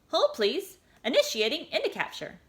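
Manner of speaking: high chirpy machine announcement; device voice. A narrator's reading. Omnipod answering the girl, high machine tone. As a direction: read it like an automated system.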